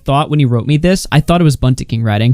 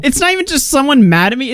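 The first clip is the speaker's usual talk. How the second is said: ordinary talk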